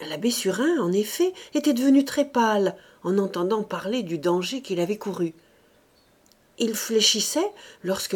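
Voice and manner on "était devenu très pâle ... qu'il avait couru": ordinary delivery, nothing marked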